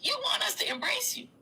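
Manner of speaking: "embrace" whispered high and breathless; mocking whisper. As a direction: hush it like a taunting secret. Repeats the line, then whispers it.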